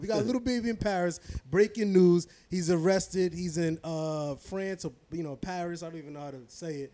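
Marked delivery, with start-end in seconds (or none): 1.5-3.3 loud news-anchor headline read; breaking news announcer. Pretend. Mock news announcement, louder.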